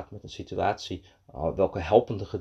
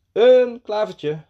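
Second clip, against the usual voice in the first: high, loud, drawn-out reveal; proud excitement; 10 semitones higher, 8 dB louder, much slower than usual. A narrator's reading. Little man showing the duck his find, proud excitement. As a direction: unveil the treasure slowly and proudly.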